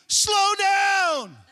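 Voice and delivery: very high, loud, drawn-out preacher's cry; fervent preaching at full pitch; full-force preaching. Real, not acted. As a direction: shout the word out to the whole church.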